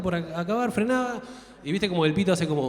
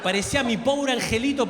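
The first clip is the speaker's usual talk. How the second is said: ordinary delivery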